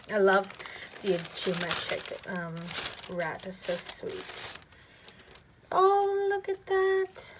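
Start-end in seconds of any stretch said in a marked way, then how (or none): 5.7-7.3 high, loud, slow gasp of delight; oh look, a lovely surprise. Real, not acted. Unboxing vlogger spots the journaling card, delighted surprise.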